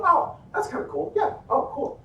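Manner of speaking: high, light, casual reaction; imitating an uninvolved viewer; mildly impressed onlooker. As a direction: toss it off in a high voice. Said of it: "And you go".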